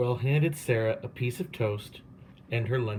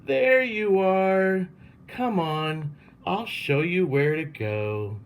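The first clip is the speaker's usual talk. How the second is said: gushing welcome; 6 semitones higher, slower than usual. A narrator's reading. Principal welcoming her at the car, gushing. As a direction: keep gushing over her. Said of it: "Miss Burton gushed".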